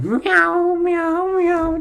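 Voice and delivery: very high mock meowing; cat meows. Imagined Halle Berry rehearsing Catwoman, squeaky meows. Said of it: "Halle Berry about to play Catwoman".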